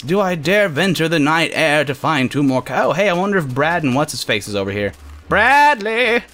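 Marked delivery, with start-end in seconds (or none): 5.3-6.2 high, drawn-out playful call. Pretend. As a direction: call for someone far away. Calls out for missing Bradley.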